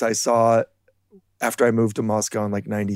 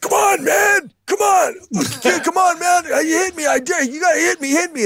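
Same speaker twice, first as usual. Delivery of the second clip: shouting taunt. Retold or imitated. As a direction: bellow at him to throw the first punch. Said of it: "He's like".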